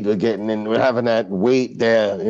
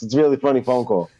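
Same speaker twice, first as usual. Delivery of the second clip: usual speaking voice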